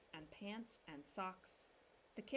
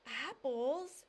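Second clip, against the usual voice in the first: higher, louder astonished; gasped question; 5 semitones higher, 8 dB louder than usual. A narrator's reading. Mama gasping up at Papa, astonished. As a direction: gasp it in surprise. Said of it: "gasped Mama".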